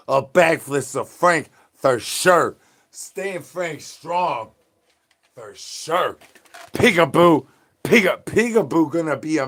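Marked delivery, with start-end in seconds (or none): 2.9-6.2 slow drawn-out slogan. Acted.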